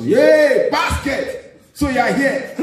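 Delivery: high, loud cheery Yay basket; excited greeting. Retold or imitated. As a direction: greet him like a long-lost friend. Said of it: "a Nigerian guy said".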